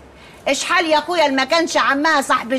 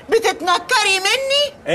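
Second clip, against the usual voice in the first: mock whimper; 7 semitones higher, much slower than usual. Aunt's mock hurt, laugh-cry.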